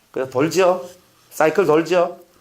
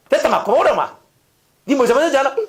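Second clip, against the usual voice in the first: loud, higher scolding; a parent yelling at a child mid-spanking; 8 semitones higher, 6 dB louder, faster than usual. Retold or imitated. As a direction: tell the kid off hard.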